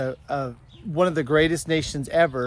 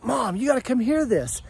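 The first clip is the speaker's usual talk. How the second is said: higher, eager mock kid voice; imitating her son; 8 semitones higher than usual. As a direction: Bryce urgently calling mom over. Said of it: "so Bryce is just like".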